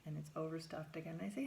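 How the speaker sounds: ordinary talk, nothing marked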